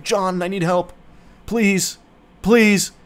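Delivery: struggling plea for help. Retold or imitated. Friend in the forest begs John for rescue, mildly hammed-up pleading. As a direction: imitate someone pleading to be rescued. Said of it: "like, struggling".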